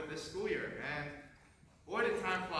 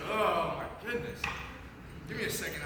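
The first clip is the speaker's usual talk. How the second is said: choked up; 4 dB louder than usual. Real, not acted. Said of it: "I'm trying not to cry right now".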